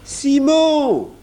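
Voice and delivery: high, sustained call of a name; high-pitched boyish shout. A narrator's reading. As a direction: cry out for someone behind a door. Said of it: "cria Jean".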